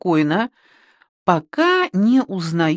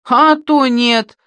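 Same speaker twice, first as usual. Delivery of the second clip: put-on old man's retort; 7 semitones higher, 6 dB louder, slower than usual. A narrator's reading. Old shepherd answers up.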